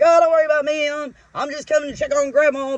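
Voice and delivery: high and loud, carefree; playing the casual intruder, easygoing; breezy grandson. Grandson brushing off the worker, loud and carefree. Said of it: "He's like".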